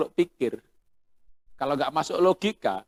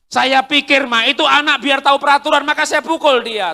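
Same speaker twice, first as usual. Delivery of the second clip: husband's voice, much higher and louder; husband loudly insisting he is right; 11 semitones higher, 11 dB louder than usual. Acted. Husband to wife about hitting child, loud and insistent.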